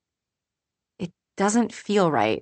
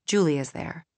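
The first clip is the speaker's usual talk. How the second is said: ordinary delivery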